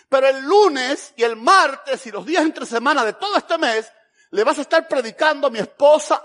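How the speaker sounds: loud, high, hammering each day; emphatic warning. Retold or imitated. Pastor warning visiting preacher nobody comes weekdays, emphatic warning.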